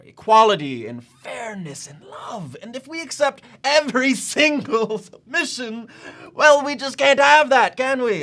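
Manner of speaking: loud, high, indignant outburst; rising anger. Acted. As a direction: let the indignation boil over. Official's rant rising.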